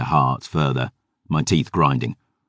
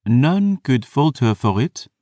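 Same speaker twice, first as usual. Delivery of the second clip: French vampire voice; 8 semitones higher than usual. Acted. Vampire historian questions.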